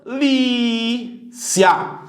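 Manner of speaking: "Polícia" stretched very slowly; drawn-out word. Shown as a demonstration. Teacher presenting the last word to students, very slow. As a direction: pull the word out long. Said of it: "olha só".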